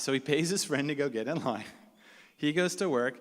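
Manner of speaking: normal talking voice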